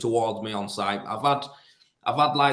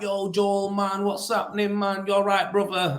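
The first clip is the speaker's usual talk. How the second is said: higher-pitched imitation of put-on street slang accent; mock street accent; 6 semitones higher than usual. Co-worker greeting Joel in presumed slang, mock street accent. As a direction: mimic a co-worker's fake matey street accent. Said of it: "do I talk like that?".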